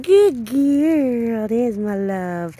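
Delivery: high cooing praise, slow at the end. Real, not acted. Trainer cooing praise at her dog. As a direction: coo to the dog. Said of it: "She likes baby talks".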